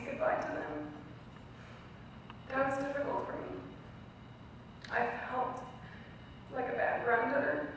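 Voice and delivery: fighting back tears, pitch climbing high; choked up, barely holding it together; tearful. Real, not acted. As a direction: voice breaking with real tears. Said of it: "forgive me if I get emotional".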